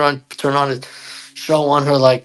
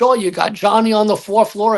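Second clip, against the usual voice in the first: ordinary talk, nothing marked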